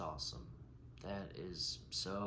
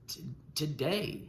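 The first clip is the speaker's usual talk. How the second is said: stuttering on the first word; 6 semitones higher than usual. Real, not acted. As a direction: stutter on the opening sound. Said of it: "I have a stutter".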